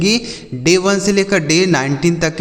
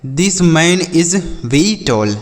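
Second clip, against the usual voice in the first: each English word said slowly for the class; slow model sentence; much slower than usual. Shown as a demonstration. Teacher to children, modelling an English sentence slowly. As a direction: spell it out slowly for learners. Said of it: "यह व्यक्ति बहुत लंबा है".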